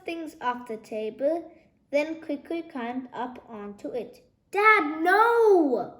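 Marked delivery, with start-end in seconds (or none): 4.5-6.0 high, loud alarmed shout. A narrator's reading. Lizzie shouting at dad to stop his jump. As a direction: shout to stop him, frightened. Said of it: "shouted Lizzie".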